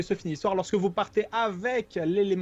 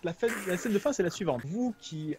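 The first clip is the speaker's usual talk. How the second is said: usual speaking voice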